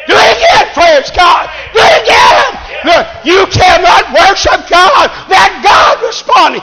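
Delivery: high-pitched, fired-up revival cry; rousing, high preacher's call; exultant preaching shout. Acted. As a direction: cry it out high with full conviction. Excited preaching of God's repeated command.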